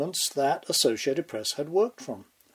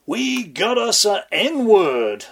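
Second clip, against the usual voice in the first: slow, loud, higher gloating crow; gleeful crowing; 7 semitones higher, 6 dB louder, slower than usual. Acted. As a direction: crow it out, hammed-up gloating cop. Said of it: "the cops crowed gleefully".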